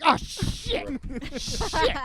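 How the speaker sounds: high, loud mock panic; panicked. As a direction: yelp like you just got caught. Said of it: "you're gonna be like".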